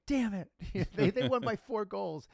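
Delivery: laughing while acting the friend's loss; retold frustration. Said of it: "he's like".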